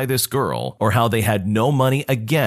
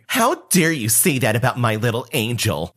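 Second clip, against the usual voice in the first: put-on voice of a pushy entitled mom; entitled Karen. Narrator voicing the Karen haggling for the Game Boy, put-on pushy voice. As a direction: play the pushy mother lowballing a kid. Karen defending her son.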